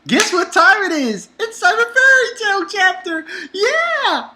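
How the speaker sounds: high, loud hyped-up show opener; excited intro. Acted. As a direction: shout it like a hype host.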